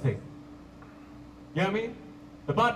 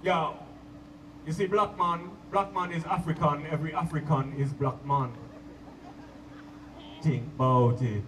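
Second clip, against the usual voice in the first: preaching roots man; 6 semitones lower than usual. Retold or imitated. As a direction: lower Jamaican accent, lay down wisdom. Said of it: "they stand there and they be like".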